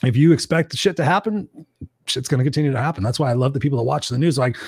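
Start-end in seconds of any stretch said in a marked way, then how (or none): none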